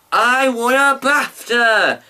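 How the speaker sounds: high, slow, loud demanding yell. Retold or imitated. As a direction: bellow it like a sore loser. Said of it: "Lee yelled".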